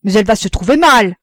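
loud, quick cry of alarm; alarmed exclamation. A narrator's reading. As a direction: cry out that something is wrong. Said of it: "son mari s'écria".

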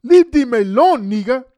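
high, loud mocking voice; mock scolding. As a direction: throw it back at them as a shrill mock rebuke. Mocking what others told them.

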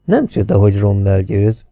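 plain reading voice

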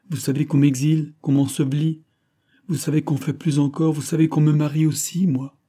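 earnest, wounded protest; aggrieved young woman, Marie. A narrator's reading. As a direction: press each grievance home.

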